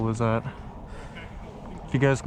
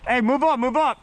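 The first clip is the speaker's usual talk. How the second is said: urgent shout; 11 semitones higher than usual. Real, not acted. Urging squad forward.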